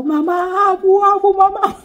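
mock desperate baby yelling for mamá and abu; imitated toddler screaming. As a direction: wail like a kidnapped toddler. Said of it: "Empezó a dar gritos".

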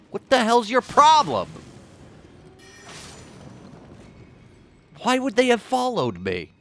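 high, loud indignant yell; loud exasperated. Real, not acted. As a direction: yell at the screen. Exclaiming at game enemies.

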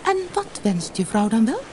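high, kindly little fish; friendly goldfish voice; friendly question. A narrator's reading. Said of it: "Vriendelijk vroeg hij aan de visser".

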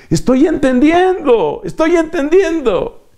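high-pitched burst of delighted understanding; excited realization. Acted. Learner grasping the Greek meaning at last, excited realization. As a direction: cry out like a learner who finally gets it.

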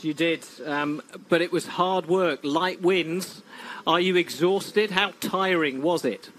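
ordinary delivery

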